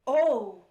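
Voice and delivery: sharp gasp into a loud "oh"; breath sucked in, then a loud oh; imitated shocked gasp. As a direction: mimic an old lady's scandalized gasp. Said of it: "the little old lady went".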